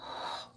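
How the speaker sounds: soft, voiceless exhale; relaxing sigh. Shown as a demonstration. Coach shows letting stress go, relaxing sigh. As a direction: let stress go on a quiet out-breath.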